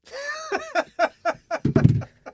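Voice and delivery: high-pitched, loud cackling laugh; real burst of laughter. Real, not acted. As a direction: crack up helplessly at a silly punchline. Listener cracks up at the punchline.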